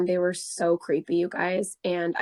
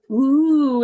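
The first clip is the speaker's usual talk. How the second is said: loud, high taunting ooh; mocking ooh; 6 semitones higher, 9 dB louder than usual. Mocking the guy's police threat with a loud ooh. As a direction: jeer at his threat.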